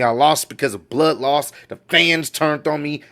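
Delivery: ordinary delivery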